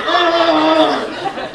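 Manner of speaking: high, loud drawn-out "argh"; imitated roar. Water slide kicking his face, roaring. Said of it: "It was just like".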